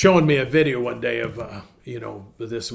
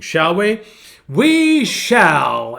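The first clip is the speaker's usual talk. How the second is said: louder, higher, stretched-out announcement; friend's catchphrase; 6 semitones higher, 9 dB louder, much slower than usual. Retold or imitated. Pastor opens the walk with a friend's saying, playful imitation, loud. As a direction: draw out the answer loud and high. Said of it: "as my friend Doug used to say".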